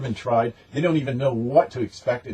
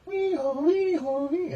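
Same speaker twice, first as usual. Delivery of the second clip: very high, mocking loony whoop; crazy siren noise; slower than usual. Showing that the guy is nuts, a high mocking whoop. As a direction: squeal it like a cuckoo siren.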